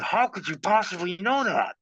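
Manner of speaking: high, amazed disbelief; stunned client. Retold or imitated. The client, shocked his secret was read, asking in high disbelief. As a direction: ask it like he can't believe his ears. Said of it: "And the guy said".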